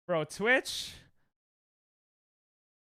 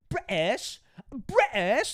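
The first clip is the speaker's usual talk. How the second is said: mock British pronunciation of "British"; attempted British accent; 6 semitones higher, much slower than usual. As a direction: try out a playful British accent. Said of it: "I don't know British".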